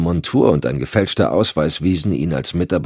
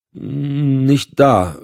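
nervous stammer, voice up; 10 semitones higher, 5 dB louder than usual. Acted. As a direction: caught out and flustered. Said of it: "stotterte der Gefragte". (stotternd, nervös)

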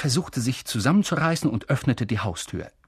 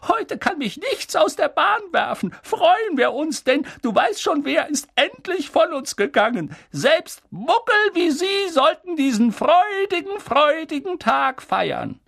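squeaky voice; 3 dB louder than usual. Acted. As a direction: squeak it out, beaming with joy. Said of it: "mit piepsiger Stimme sagte".

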